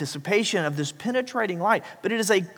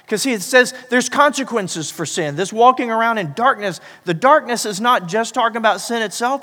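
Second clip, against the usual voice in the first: rising, louder preacher's cry; preaching at full force; 6 semitones higher, 6 dB louder than usual. Acted. Pastor warning the congregation about sin, preaching hard. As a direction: drive it home to the congregation, building.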